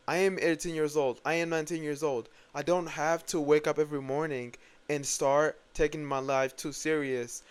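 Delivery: ordinary talk, nothing marked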